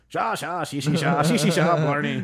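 exaggerated Irish babble of shushing syllables; hammed-up Irish gibberish; mock Irish gibberish. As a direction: reduce an Irish brogue to loud nonsense. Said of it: "Isn't the way you say".

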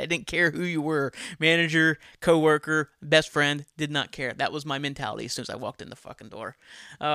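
ordinary delivery

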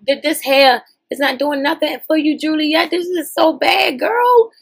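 drawn-out scandalized whine; mock disgust. Real, not acted. To Juliet on screen, a mock disgusted whine. Mocking a contestant's bad hair.

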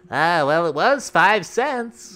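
put-on high, slow old-timer reminiscing; lightly voiced old man. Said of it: "they're thinking to themselves".

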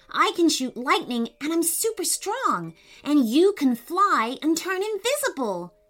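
high, breathless-with-news enthusiasm; excited explaining. A narrator's reading. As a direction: gush out your theory to your sister. Boy excitedly explains superpowers.

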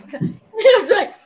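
high laughing protest. Real, not acted. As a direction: laugh while insisting. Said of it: "laughing at mix-up".

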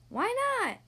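high, perky squirrel voice; curious squirrel. A narrator's reading. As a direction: ask high and keen. Squirrel questions the tortoise.

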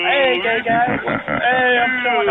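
mocking shout. Real, not acted. Mocking caller loudly on chat line.

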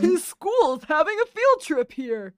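put-on slow, loud, high elderly man; old geezer, slow and loud. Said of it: "I love your old man voice".

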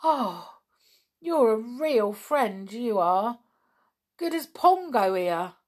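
put-on high, loud, grateful boy; lifted, emphatic happy voice; delighted. A narrator's reading. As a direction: gush with praise for a friend. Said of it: "his face lighting up".

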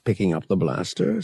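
ordinary delivery, nothing marked

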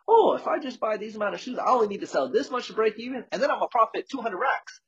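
high, dreamy mock of a naive new founder. Mocking every new entrepreneur to the host, high dreamy voice. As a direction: lampoon the wide-eyed founder counting profits. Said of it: "you're like".

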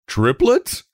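high, rising shocked question; appalled. A narrator's reading. Father asks doctor about the birth, appalled. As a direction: ask it aghast, pitch leaping up.

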